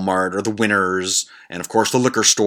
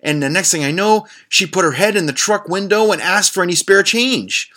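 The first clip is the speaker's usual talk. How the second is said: voicing daughter; 8 semitones higher than usual. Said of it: "she says".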